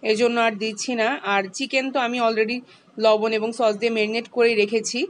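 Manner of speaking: ordinary talk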